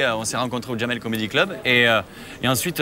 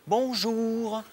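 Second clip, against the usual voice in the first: voix plus haute et lente de client innocent; client faussement poli; 7 semitones higher, slower than usual. Acted. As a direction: joue le client qui fait mine de rien. Customer asks seller for channel. (le client demande la chaîne au vendeur, faussement innocent)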